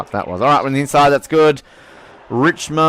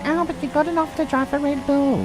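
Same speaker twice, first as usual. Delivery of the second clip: high, whiny mock fan voice; mocking long-term fans. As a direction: squeak it like a know-it-all viewer. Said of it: "are going".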